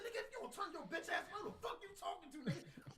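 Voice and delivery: very high, held-down threat; high mock threat without volume; retold confrontation. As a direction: act out a squeezed, high-pitched challenge.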